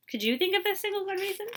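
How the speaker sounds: ordinary delivery, nothing marked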